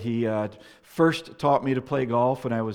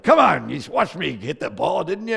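exasperated grandpa; 6 semitones higher, 8 dB louder than usual. Retold or imitated. Grandfather scolding the missing kid.

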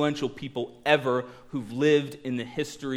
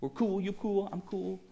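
soft, higher mellow voice; hushed, easygoing mock hippie; laid-back peacenik; 5 semitones higher, 7 dB quieter, slower than usual. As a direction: play a harmless chilled-out guy, quietly. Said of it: "walking around just going".

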